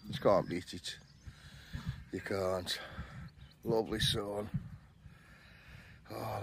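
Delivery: soft, low, half-whispered; hushed morning voice. Real, not acted. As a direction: keep it down so nobody wakes. Early morning, others still asleep.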